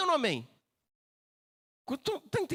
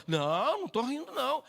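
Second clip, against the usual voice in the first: serious denial; 4 semitones higher than usual. Acted. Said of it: "Ela chegou sério".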